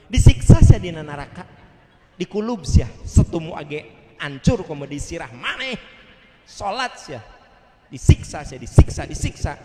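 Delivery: loud, forceful scolding; mock furious parent berating a child; harsh threatening parent. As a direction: hammer the threat home, loudly.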